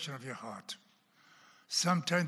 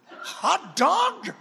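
laughing; 14 semitones higher, 10 dB louder than usual. Real, not acted. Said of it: "repeats quote laughing".

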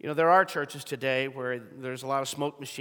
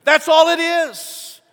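high, loud, slow cry of "It's just four green balls"; scream it out; 12 semitones higher, 12 dB louder, much slower than usual. Acted. Preacher crying out to the church in frustration, shouting. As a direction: shout it like you can't hold it in. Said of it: "wants to scream out".

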